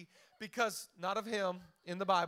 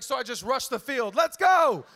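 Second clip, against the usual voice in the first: loud, high rush onto the field; retold hype shout; 4 semitones higher, 11 dB louder than usual. Friend retelling rushing the field, loud hype shout. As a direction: yell it like charging out. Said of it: "He's like".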